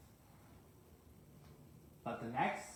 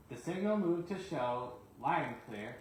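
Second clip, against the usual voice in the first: plain reading voice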